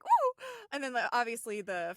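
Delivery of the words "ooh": squealed high and loud; very high delighted ooh. Retold or imitated. To her cohost, a high delighted squeal at the reference. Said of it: "I was like".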